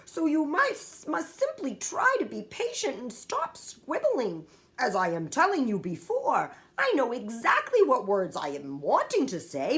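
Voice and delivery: a gentle giant pleading for patience; a giant sadly explaining his muddled words; friendly giant. A narrator's reading. BFG laments his words.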